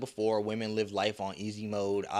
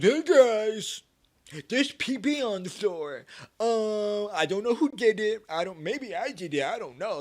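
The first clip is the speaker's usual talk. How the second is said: much higher, louder, whiny mock-innocent voice; mock clueless voice; 11 semitones higher, 7 dB louder than usual. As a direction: play dumb in a silly high voice. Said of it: "Like, I just came in here clueless".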